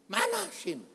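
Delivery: high-pitched mock-angry demand; angry relatives. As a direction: act out an annoyed sibling, pitched high. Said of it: "Si kakak marah".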